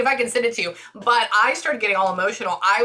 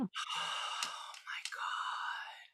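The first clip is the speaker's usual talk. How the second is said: slow whispered sigh, near crying; tearful whisper; 4 semitones higher, 17 dB quieter, much slower than usual. Real, not acted. Medium moved to tears.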